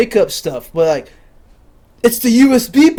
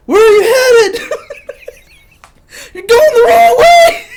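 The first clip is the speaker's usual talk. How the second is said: high, loud shout at a runaway player; mock teammates shouting, much higher and louder; teammates yelling; 9 dB louder than usual. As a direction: yell across the field at him. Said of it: "my own players are like".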